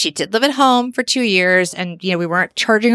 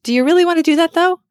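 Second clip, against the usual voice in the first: higher, louder, fast pointed question; incredulous challenge; 9 semitones higher, 4 dB louder, faster than usual. Retold or imitated. Retelling a talk with the daughter, incredulous and fast. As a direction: push back on the daughter, half disbelieving. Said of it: "And I'm like".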